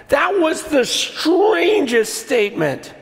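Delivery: normal talking voice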